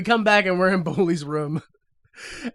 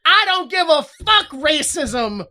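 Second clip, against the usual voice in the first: mock outrage; 7 semitones higher, 5 dB louder, slower than usual. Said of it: "And I was like".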